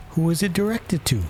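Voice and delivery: high-voiced juryman's question. A narrator's reading. Juryman asking about the letter, high voice. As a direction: pitch the query up high. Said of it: "said one of the jurymen".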